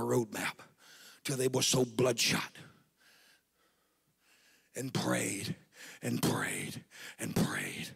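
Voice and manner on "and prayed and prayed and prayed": much softer, slow and low; repeating prayed, fading down to a hush; hushed repetition. Acted. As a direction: let each prayed sink quieter. Praying in quiet repetition.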